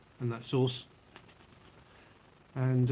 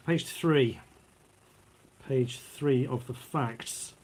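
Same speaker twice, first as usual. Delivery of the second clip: ordinary delivery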